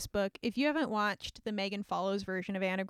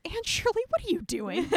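Gilbert, surprised; 7 semitones higher than usual. Retold or imitated. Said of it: "Gilbert Blythe just".

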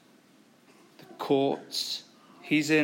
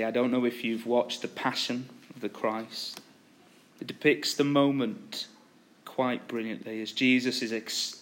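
ordinary delivery

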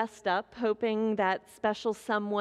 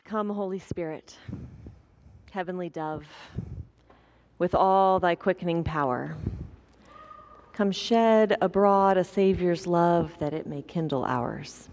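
lower, hushed prayerful voice; 5 semitones lower than usual. Acted. Pastor praying before the sermon, hushed and reverent.

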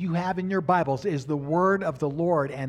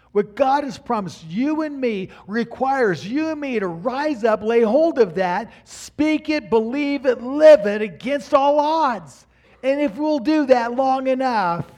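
loud, high, rising preacher's cry; climbing sermon climax; full-force preaching; 10 semitones higher, 9 dB louder than usual. Acted. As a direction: build it to a shout from the pulpit.

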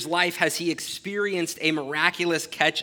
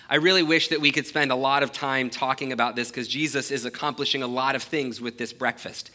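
normal talking voice

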